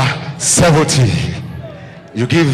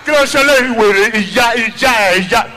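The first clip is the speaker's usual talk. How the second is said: ranting shout; 8 semitones higher than usual. Retold or imitated. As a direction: rant at him without a breath. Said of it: "In the shout".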